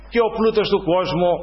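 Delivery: ordinary delivery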